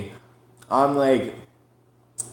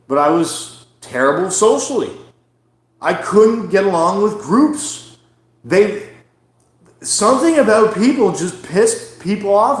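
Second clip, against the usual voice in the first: raised, louder angry venting; real anger; 7 semitones higher, 6 dB louder than usual. Real, not acted. Ranting to podcast listeners about people, loud and angry. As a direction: let the frustration boil over loudly. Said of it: "this is kind of why I'm angry right now".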